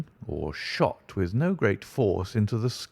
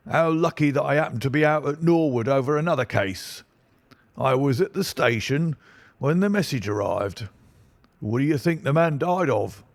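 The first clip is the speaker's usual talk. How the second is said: pompous inspector; 6 semitones higher than usual. Acted. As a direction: talk down to him with bluster. Inspector holding forth.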